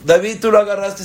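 put-on mother insisting the boy took it. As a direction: press the child harder. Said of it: "empieza a gritar".

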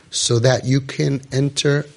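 ordinary talk, nothing marked

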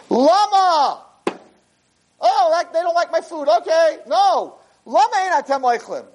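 high, pleading cry of why; upset host. Retold or imitated. Father pleading with guests who won't eat, high and hurt. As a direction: shoot way up in hurt disbelief.

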